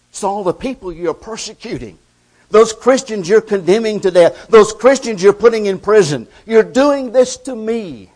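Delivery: loud, driving preacher's cadence; full-force preaching. Acted. Preacher to his congregation, booming sermon delivery.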